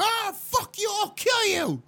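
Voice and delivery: high-pitched, acted rage; mad screaming. Showing how they vent when mad, acted screaming. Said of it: "if I'm mad and I go".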